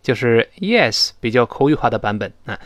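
ordinary delivery

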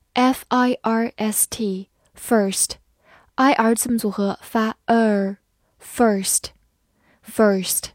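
slow spelled first, I R as er; spelling drill. Shown as a demonstration. (慢速拼读)

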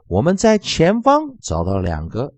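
usual speaking voice

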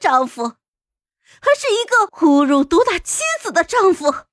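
high, loud, bitter outcry; hoarse screaming. A narrator's reading. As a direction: scream it out in raw outrage. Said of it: "一边嘶声喊道".

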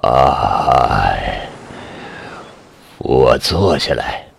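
low, slow hoarse plea; weak hoarse voice full of fear; hoarse and afraid. A narrator's reading. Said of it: "嗓子依然沙哑，声音充满惧怕".

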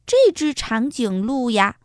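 usual speaking voice

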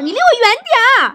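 high, loud, quick outburst; hammed-up furious wife; very angry. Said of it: "王嫂特别生气". (夸张地尖声怒喊)

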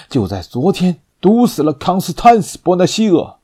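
rising, loud furious accusation; angry accusation. Acted. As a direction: accuse her with mounting rage.

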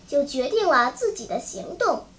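ordinary delivery, nothing marked

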